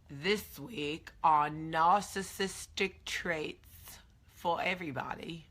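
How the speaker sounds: slow, badly faked British accent. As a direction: stretch it out in a terrible mock-British voice. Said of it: "That was the worst British accent".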